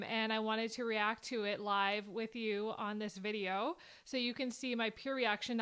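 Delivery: ordinary delivery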